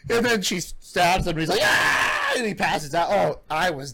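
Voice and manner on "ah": very high mock scream; comic yelp of pain; imitated scream. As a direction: cry out high, as if stabbed. Imitates man being stabbed.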